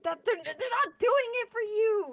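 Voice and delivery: very high, squeaky exasperation; exasperated protest. Retold or imitated. Woman retelling how she told her companion off, exasperated and high. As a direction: replay a scolding in a strained high pitch. Retold exasperated reply to him.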